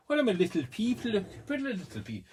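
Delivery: very high, silly attempt at a French accent; mock French accent. Vlogger clowning about her little people, mock French accent. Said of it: "don't try and do a Fr-, uh, French".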